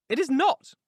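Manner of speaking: sharp, high, loud comeback; retort. A narrator's reading. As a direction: fire it straight back. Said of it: "retorted she".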